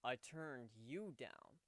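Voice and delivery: drawn-out, patient correction; slow and deliberate. Acted. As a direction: say it slowly for emphasis.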